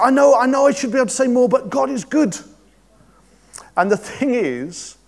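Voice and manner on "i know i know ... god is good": much higher and louder, fast and breathless; elated testimony. Retold or imitated. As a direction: rush it out, overflowing with happiness. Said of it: "And she said".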